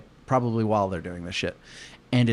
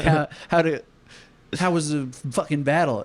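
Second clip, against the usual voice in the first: higher put-on interviewer voice; mock interviewer; 5 semitones higher than usual. Interviewers asking the general about the battle, higher mock voice. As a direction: ask it like an eager reporter. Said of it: "And they're like".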